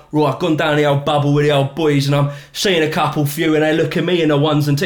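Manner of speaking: overdone Cockney geezer slang, lightly voiced; fake Cockney Danny Dyer. Mocking Danny Dyer walking into a pub, exaggerated Cockney accent. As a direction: pile on the Cockney like a put-on geezer. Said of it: "he was going in there being like".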